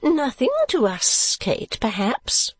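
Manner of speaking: "Nothing" drawn out high, the rest slow and falling; emphatic. A narrator's reading. Mother conceding to her daughter, "Nothing" emphatically high and drawn out. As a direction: lean slowly and high on "Nothing". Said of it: "said Missus Nickleby emphatically".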